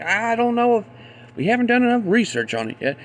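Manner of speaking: high fast mocking whine; mocking media. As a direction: parody their excuse. Mocks media claim.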